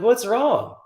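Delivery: curious bystander. Retold or imitated. Truck guys pulling up asking, high voiced. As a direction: call out from the truck.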